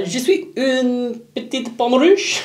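high, slow Je suis une petite pomme rouge; slow, high sing-song French; proud bad French. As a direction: recite it slowly and proudly like a tourist. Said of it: "I would say".

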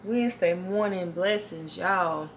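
slow, measured devotional recital; preaching. Acted. Woman opening her morning video with a devotional recital. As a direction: intone each line like a sermon refrain.

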